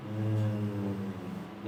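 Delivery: long sustained low buzzing fricative; sustained interdental fricative hum; drawn-out fricative demo. Shown as a demonstration. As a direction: hold the sound out to show it can last. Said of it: "la puedo arrastrar indefinidamente".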